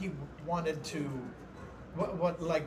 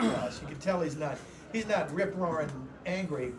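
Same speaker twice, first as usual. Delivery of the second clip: usual speaking voice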